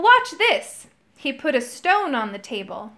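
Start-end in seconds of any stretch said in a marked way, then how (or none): 0.0-0.9 high, loud boast; showing off. A narrator's reading. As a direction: show off, thrilled. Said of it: "said Moongobble".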